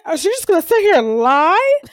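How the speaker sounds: high, loud outraged accusation; mock indignant outburst. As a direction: imitate an affronted coworker. Retold accusation by upset coworker.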